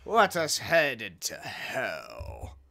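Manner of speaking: slow whispered; dramatic whisper; ominous whisper. Real, not acted. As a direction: whisper it like a dark secret. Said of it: "whispered ominously".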